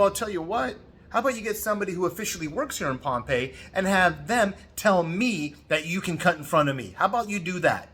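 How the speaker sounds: taunting tone at the tour guide; sarcastic challenge. Retold or imitated. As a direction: needle him into fetching real staff. Confronting line cutter in Pompeii.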